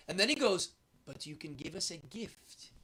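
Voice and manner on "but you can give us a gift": slow, softer sly hint; sly guard. Retold or imitated. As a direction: slide the bribe request in quietly. Guard hints at a bribe.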